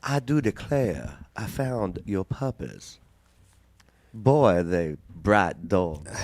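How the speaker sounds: slow, hammed-up Southern belle drawl; genteel Southern woman. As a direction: draw it out like a Southern lady. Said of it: "You sound like a genteel Southern woman".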